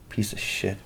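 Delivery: softly muttered jab; quiet insult. Real, not acted. Muttered, then shouted at Luke.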